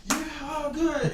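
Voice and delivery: high-pitched imitation of a cheering crowd; mock cheering. Host mimicking the cheering movie theater, mock cheering. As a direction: put on a gushing audience cheer. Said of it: "was cheering like".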